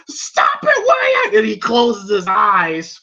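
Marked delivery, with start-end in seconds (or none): 0.1-1.4 Mickey's shout. Retold or imitated. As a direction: imitate a cornerman yelling to stop. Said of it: "you hear Mickey go".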